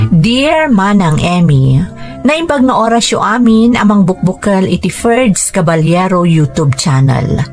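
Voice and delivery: ordinary talk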